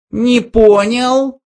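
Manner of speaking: high, slow mouse challenge; menacing mouse. A narrator's reading. Mouse challenges the men toasting, menacing high voice. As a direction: stretch it out as a threat, pitched high. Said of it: "грозно сказал Бенджи".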